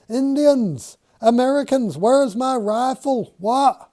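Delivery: high, loud panicked shouting; panicked scream. Acted. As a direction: wake up screaming in terror. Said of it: "He is awake and screaming".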